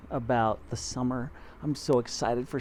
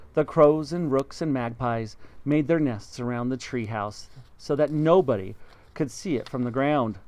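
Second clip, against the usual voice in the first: plain reading voice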